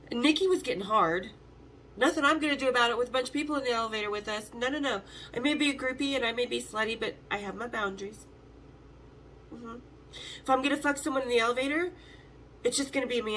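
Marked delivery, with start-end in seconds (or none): none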